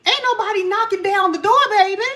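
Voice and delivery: sassy quote. Retold or imitated. Old friend telling the reader nobody's knocking, sassy teasing. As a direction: hand out a playful blunt reality check. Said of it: "This person was like".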